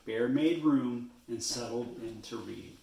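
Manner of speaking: ordinary delivery, nothing marked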